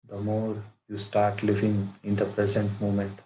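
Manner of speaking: measured meditation guide with long pauses; guided meditation. Acted. Teacher guiding friends to watch their breath, guided meditation. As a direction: lead them into stillness, phrase by phrase, in an even tone.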